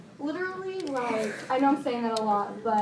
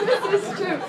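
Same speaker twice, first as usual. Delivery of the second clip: laughing; 10 semitones higher, 9 dB louder than usual. Real, not acted. Laughing at teammate joke.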